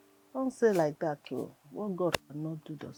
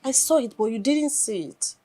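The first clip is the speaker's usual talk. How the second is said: raised, louder I-told-you-so; scolding reproach; 4 semitones higher, 8 dB louder than usual. Acted. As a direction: chide her for not listening. Friend scolds about the marriage.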